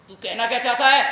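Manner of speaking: loud, confrontational challenge; angry demand. Acted. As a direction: raise your voice and demand an answer. Same challenge repeated, now angry.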